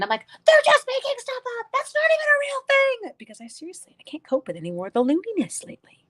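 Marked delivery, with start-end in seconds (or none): 0.4-3.1 high, loud mock-meltdown wail; meltdown outburst. Crafter retelling her storm-news meltdown, high exasperated outburst. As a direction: re-enact a meltdown at full pitch. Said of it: "I had this full-on meltdown last night".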